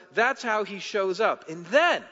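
preacher pressing the point to the congregation, full force; fiery preaching. Acted. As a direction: preach it out with conviction.

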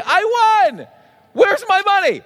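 high-pitched jubilant demand; hammed-up, high excited exclamation; acted triumph. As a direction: play a winner claiming the prize. Acting winner claiming the prize.

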